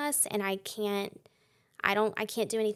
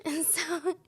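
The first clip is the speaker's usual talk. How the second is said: whisper; 8 semitones higher than usual. Real, not acted. Aside after covid hit.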